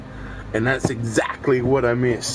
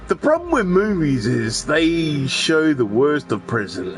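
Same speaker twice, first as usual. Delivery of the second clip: usual speaking voice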